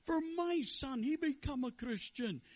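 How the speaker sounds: high, loud comic rabbi. Acted. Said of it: "The Rebbe looks around and says".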